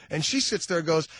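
ordinary talk

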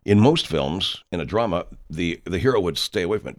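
ordinary talk, nothing marked

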